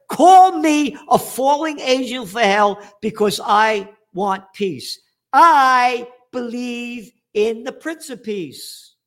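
outraged rant at a German politician; indignant rant. Real, not acted. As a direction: hammer home the outrage. Angry rant at Schultz.

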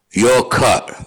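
slow, close-up English rock star drawl. Retold or imitated. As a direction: lean in and deliver it in a heavy English accent. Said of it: "in his heavy accent".

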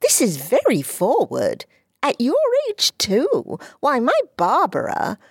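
put-on a stuffy man in a boy's mouth; a hint of a prudish boy; prim shocked boy. Paul shocked.

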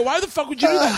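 high-pitched, exasperated demand; indignant squawk, played for comedy; retold indignant protest. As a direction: re-enact an outraged protest to a friend. Retold quarrel over cut-off drinks.

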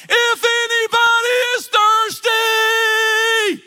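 high, slow, loud proclamation; shouting out. Retold or imitated. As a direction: call it out over a crowd, slow and loud. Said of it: "he shouts out".

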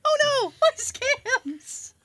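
high, slow mock sobbing; hammy stage actor. Hammy fake crying.

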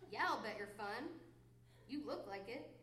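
ordinary talk

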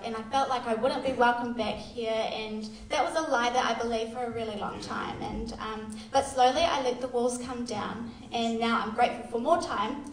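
ordinary talk, nothing marked

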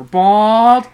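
loud high shout of the word. Real, not acted. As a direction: belt it out as a punchline. Mock shouting the joke.

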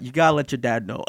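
low, level retold advice; composed, low-voiced delivery; calm. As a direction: say it calmly, pitched well down. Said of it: "I said this all in the calm demeanor".

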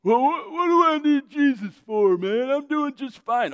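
high, put-on dismissive voice; mock a self-sufficient proud man; prideful scoffer. Proud person refusing Jesus, mocking high voice.

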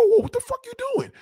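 very high, fast startled whoa; startled yelp. Acted. Straight man panicking at a finger, yelping high and fast. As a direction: yelp in alarm.

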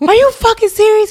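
high, loud mock outrage; a friend hears he smoked without you, shrieking; outraged disbelief. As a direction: squeal it, betrayed and amused. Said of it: "I said".

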